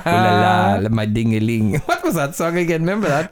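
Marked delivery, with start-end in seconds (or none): 0.1-1.7 low mock-French chant. Host plays up ooh la la for co-host, mock French. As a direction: lean into a silly French accent. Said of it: "give us another ooh la la".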